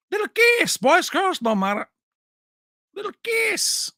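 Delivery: high, loud teasing kiss line; mock mobster voice. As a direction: taunt them in a high squeaky voice. Acting a mobster scene, high voice.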